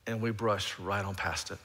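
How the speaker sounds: ordinary talk, nothing marked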